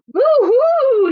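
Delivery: high, loud owl cry; alarmed storybook owl; owl hoot. Acted. Owl hoots in fright.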